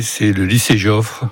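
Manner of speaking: ordinary talk, nothing marked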